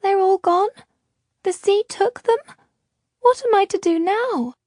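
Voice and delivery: put-on a small girl asking what will become of her; lost little girl. A narrator's reading. Orphaned girl pleading with Luca and Ishrak, high and lost.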